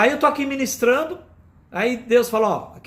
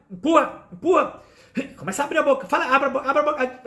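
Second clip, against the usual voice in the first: lightly mimicked high, insistent commands; mock pushy minister; 5 semitones higher than usual. Preacher mimics a minister pushing someone to fall, mock insistent. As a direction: imitate a minister's repeated urging, pitched higher.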